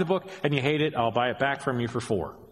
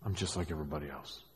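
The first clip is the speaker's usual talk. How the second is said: fast and much softer, brushing it off; mumbled excuse; 7 semitones lower, 9 dB quieter, much faster than usual. Retold or imitated. Shrugging off sin to the congregation, mumbled fast. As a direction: toss it away under your breath, quickly.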